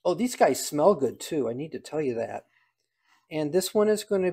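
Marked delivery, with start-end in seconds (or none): none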